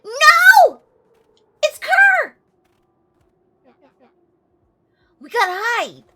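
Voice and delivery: loud alarmed cry; panicked shout. Acted. Sudden alarm, hiding from enemy.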